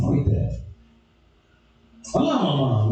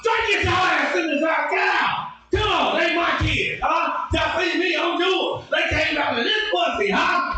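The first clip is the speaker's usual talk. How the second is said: mom going off; 11 semitones higher than usual. Retold or imitated. Mother shouting at the office staff, going off. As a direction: yell it at the whole office. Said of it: "Mama going off".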